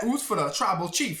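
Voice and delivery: usual speaking voice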